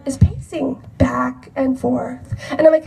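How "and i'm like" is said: ordinary talk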